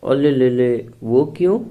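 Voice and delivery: put-on playful babyish tease; teasing baby talk. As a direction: coo at her as if at a pouting baby.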